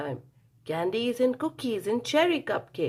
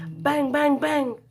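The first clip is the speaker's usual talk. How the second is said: banging; 7 semitones higher, 5 dB louder, slower than usual. A narrator's reading. Loud banging read.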